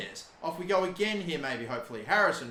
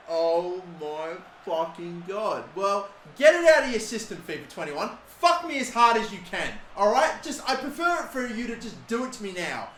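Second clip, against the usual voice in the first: higher and louder, bitter sarcasm at the game; exasperated rant; 4 semitones higher, 5 dB louder than usual. Real, not acted. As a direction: yell your surrender to the game. Ranting at the game.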